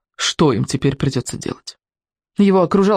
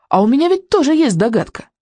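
put-on higher-pitched insistent boy; 8 semitones higher than usual. A narrator's reading. Boy offers his own guess, insistent. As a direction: press the point in a raised pitch. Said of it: "настойчиво сказал Икинг".

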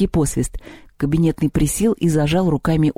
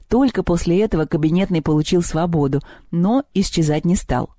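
plain reading voice

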